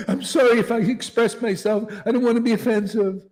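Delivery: high, loud whiny apologetic put-on voice; mock wimp voice. Preacher parodying a timid devotee afraid to offend, in a high whiny voice. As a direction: play a cringing wimp apologizing, fast at the end.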